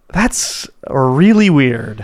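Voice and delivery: very slow, stretched reaction; slow, stretched "really weird"; slow drawn-out. Retold or imitated. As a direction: draw it out slowly in disbelief. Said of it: "And I was just like".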